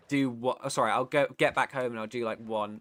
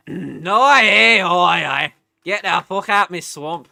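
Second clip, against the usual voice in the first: put-on loud, fumbling stab at a Scottish accent, Shrek-style; 4 semitones higher, 16 dB louder, slower than usual. Chat asks for her best Scottish accent, loud botched Scottish. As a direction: belt out a half-remembered Scottish Shrek line. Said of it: "best Scottish accent".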